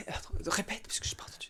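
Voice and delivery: soft whispered aside; whispering. Real, not acted. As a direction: whisper it quietly. Whispered aside about echo.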